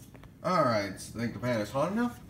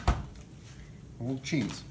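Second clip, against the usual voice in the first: whispering; 5 dB quieter, much slower than usual. Acted. Whispered aside.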